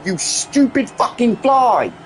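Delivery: ordinary delivery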